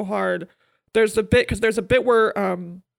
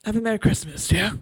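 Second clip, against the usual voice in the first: put-on menacing Christmas greeting; mock menacing. Cop wishing her partner Merry Christmas, with mock menace. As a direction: make a festive greeting faintly threatening. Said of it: "You make 'Have a Merry Christmas' sound menacing".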